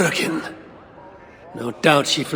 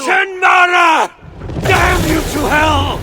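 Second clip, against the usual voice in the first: much higher, louder and slow, raging; furious shout; 5 dB louder, slower than usual. Acted. Hero screams after the enemy who escaped him, furious shout. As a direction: bellow the curse after her.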